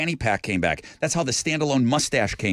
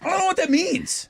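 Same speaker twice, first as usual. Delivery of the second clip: high mock voice. Mocking own confusion.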